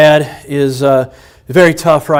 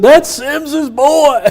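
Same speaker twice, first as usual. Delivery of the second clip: put-on high, very slow drawn-out old man; Bob Smith; 11 semitones higher, much slower than usual. Old family friend pointing him out at the funeral, high slow drawl. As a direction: drag out That's Sims's boy like the old man did. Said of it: "He said".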